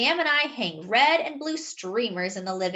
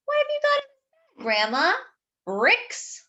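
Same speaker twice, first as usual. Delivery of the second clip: put-on teasing boy; 13 semitones higher than usual. A narrator's reading. Sam teasing Grandma on stairs.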